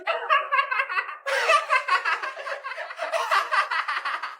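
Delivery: high mocking cackle; mocking laugh. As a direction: laugh down at the kid. Bully mocks poor kid.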